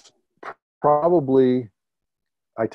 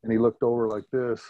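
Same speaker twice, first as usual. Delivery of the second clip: normal talking voice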